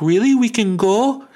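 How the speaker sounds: high, loud, eager question; hopeful surprise. Retold or imitated. Detainee asking police if he can go, hammed-up hope. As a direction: re-enact delighted disbelief at being released.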